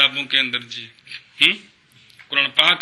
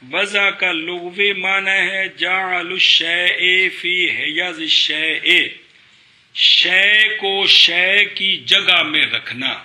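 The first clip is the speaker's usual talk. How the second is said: very slow, drawn-out definition; stretched, deliberate teacher delivery; slow dictation; slower than usual. Real, not acted. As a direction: dictate it word by word to students. Teacher dictates Arabic meaning and translation.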